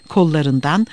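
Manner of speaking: plain reading voice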